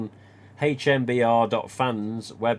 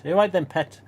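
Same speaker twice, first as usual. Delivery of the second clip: put-on Geordie accent; 6 semitones higher, 5 dB louder than usual. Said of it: "In the northeast, the, the women refer to the partners as pet".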